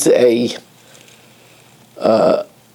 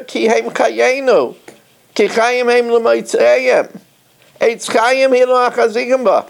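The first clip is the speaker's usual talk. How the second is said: fervent quoting; 8 semitones higher than usual. Real, not acted.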